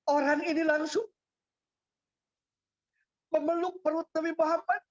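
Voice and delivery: high, tearful preaching voice; emotional preaching. Acted. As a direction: preach it with rising emotion, near tears. Emotional climax of the story. (nada khotbah tinggi penuh haru)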